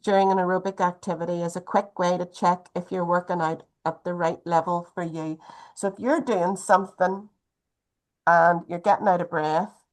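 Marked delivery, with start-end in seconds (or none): none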